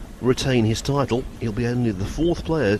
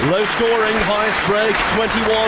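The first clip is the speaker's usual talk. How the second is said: higher and louder, rising excitement; excited commentary; 11 semitones higher, 4 dB louder than usual. Real, not acted. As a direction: lift the voice for the big moment.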